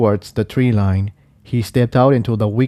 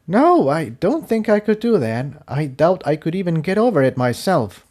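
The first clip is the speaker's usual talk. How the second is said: higher, apologetic refusal; a pony admitting she can't; doubtful companion; 7 semitones higher than usual. A narrator's reading. Tiptoe declines carrying him.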